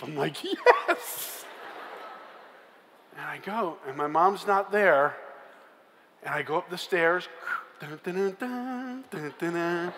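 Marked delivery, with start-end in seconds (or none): 0.4-1.4 very high, quick yes; sneaking in, high relieved yes; triumphant whisper-cheer. Retold or imitated. Relieved dad's asleep. 7.8-9.9 soft ominous dun dun; climbing the stairs, soft suspense music hum. Retold or imitated. Suspense sound going upstairs.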